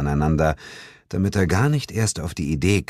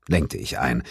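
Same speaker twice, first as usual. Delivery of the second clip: plain reading voice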